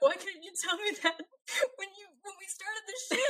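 high, soft whine through laughter; hammed-up tearful pleading; mock crying. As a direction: whimper the complaint, half laughing. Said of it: "As you're crying".

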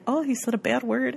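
soft, poor imitation of an Irish accent; attempted Irish accent. [name] scolds a swear word, bad Irish accent. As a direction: try an Irish accent, quietly. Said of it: "I can't do an Irish accent".

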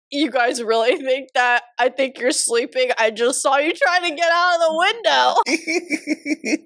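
imitating dad through laughter; mock scolding that breaks into giggles; laughing dad quote. Acts out dad catching them, laughing.

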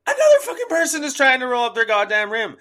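loud, high-pitched indignant rant; exasperated outburst. Real, not acted. Ranting about seniors still rolling up rims, exasperated shout. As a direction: raise your voice high in disbelief.

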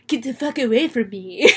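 a hint of a snooty old father; lightly put-on haughty grandpa; snooty dad voice. Imitating the actor's snooty dad voice, lightly done. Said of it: "the way he does his dad's dad voice is like".

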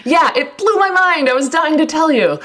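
high-pitched, eager delight; excited. Real, not acted. Host finally shares news with co-host, excited. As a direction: gush with real excitement.

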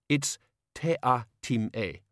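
"te a tim e" said syllable by syllable; spelled-out name. Acted. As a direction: correct "te a tim e" pointedly. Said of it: "I have to repeat".

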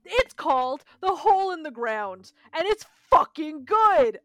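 fired-up, insistent endorsement; excited outburst. Real, not acted. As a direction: shout its praises, drawing out the last words. Gushing over favourite horror film.

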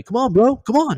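high, loud holler across a distance; re-enacted shout to hurry up; calling someone over. Distant cadet waving to the jumper, calling him over.

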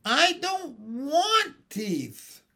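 high, drawn-out tearful yell; furious roar. A narrator's reading. Baby John refusing teeth, roaring furiously. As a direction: bawl each word slowly in fury. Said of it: "roared John furiously".

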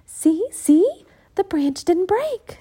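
put-on a keen girl coaxing her brother; lively Annie. Annie delighted.

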